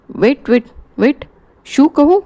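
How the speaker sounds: high, sudden stop-and-ask; startled. Acted. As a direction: freeze mid-call in surprise. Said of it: "थपकून". (थबकून, चकित)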